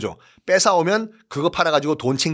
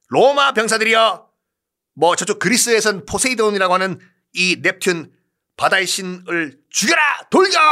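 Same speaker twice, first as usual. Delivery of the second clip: roaring rallying speech; 5 semitones higher than usual. Acted. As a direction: rouse the troops to charge. Emperor's charge speech.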